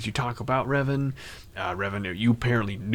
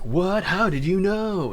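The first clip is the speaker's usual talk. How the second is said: mock surprise; 8 semitones higher, slower than usual. Player character's surprise retold.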